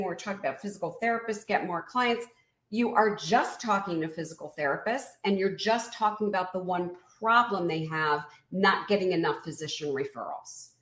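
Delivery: ordinary talk, nothing marked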